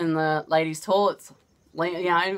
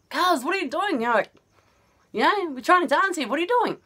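high, exasperated mimic of herself; 10 semitones higher, faster than usual. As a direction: complain while dancing. Annoyed at cousin pulling her.

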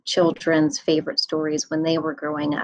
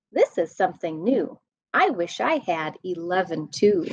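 lively sing-song read-aloud voice; animated bedtime story reading; reading to children; 5 semitones higher than usual. A narrator's reading. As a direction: read it bouncy for little kids.

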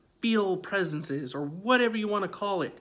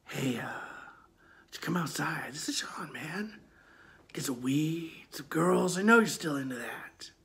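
soft unvoiced coaxing whisper; eerie whisper; 3 dB quieter than usual. Stranger on the phone whispering to the boy. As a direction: lure him outside in a hushed voice. Caller whispering to come outside.

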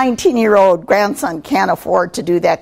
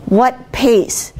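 normal talking voice

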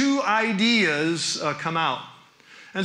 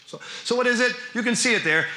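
ordinary delivery

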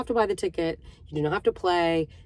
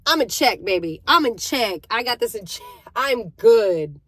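mock defensive; 4 semitones higher, 6 dB louder than usual. Defensive retort to cashier.

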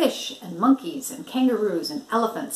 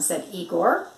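plain reading voice

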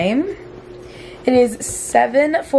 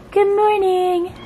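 high slow cooing; 10 semitones higher, slower than usual. Real, not acted. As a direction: coo it sweetly to the dog. Greets dog, high slow coo.

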